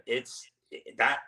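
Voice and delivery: ordinary talk, nothing marked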